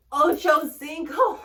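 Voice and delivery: slow, loud, high cry through laughter; laughing shout. Real, not acted. Cracking up at her own joke, loud laughing shout.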